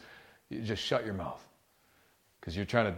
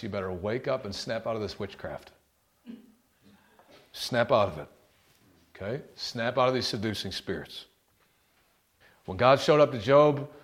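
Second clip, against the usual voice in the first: ordinary talk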